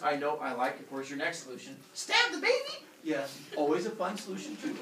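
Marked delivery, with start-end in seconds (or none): 1.9-2.9 very high, loud comic cry; gleefully hammed-up suggestion; gleeful mock exclamation. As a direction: shout it in a squeaky high pitch. Shouts out absurd next solution.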